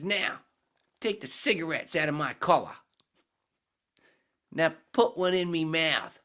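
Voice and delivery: high, loud Aussie bloke's voice with a drift toward British; Australian accent. Acted. Head orders a beer from the bartender, loud high Australian accent. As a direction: belt it out as a brash Australian drinker, way up high. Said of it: "Australian accent keep going into British".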